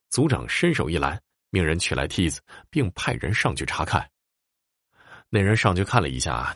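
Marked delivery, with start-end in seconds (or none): none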